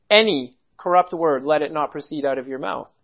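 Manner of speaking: emphatic ANY, falling away afterwards; stress on ANY. Shown as a demonstration. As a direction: hit Any, then drop the pitch for the rest. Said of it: "Paul's emphasis is on any corrupt word".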